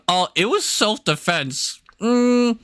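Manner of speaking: slow, sneering mock excuse; a touch of a smug shooter, mocked. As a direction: drawl out the fake excuse. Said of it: "they can claim".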